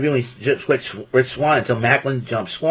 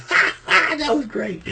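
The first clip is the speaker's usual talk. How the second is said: mock laugh; 11 semitones higher than usual. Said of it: "I was like".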